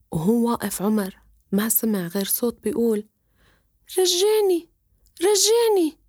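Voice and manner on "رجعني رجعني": high, squeaky little fish voice begging; a small talking fish pleading to go home. Acted. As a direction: beg like a tiny magic fish. Talking fish begs to be returned.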